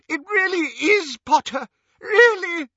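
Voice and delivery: loud, very high desperate wail; sobbing insistence. A narrator's reading. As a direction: insist tearfully, voice way up. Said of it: "sobbed Xenophilius".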